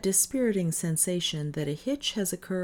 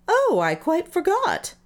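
sudden recollection; 12 semitones higher, 6 dB louder than usual. A narrator's reading. Davis suddenly remembers introductions.

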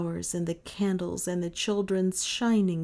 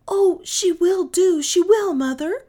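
eager cry; 12 semitones higher, 9 dB louder than usual. A narrator's reading. Children begging their mother to keep Nan, crying out eagerly. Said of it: "cried Kay and Gentian in one breath".

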